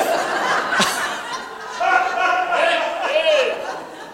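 big hearty burst of laughter. Real, not acted. Congregation laughing at joke.

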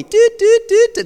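loud playful dial tone beeps. Retold or imitated. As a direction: imitate a phone hanging up. Caller hangs up, dial tone.

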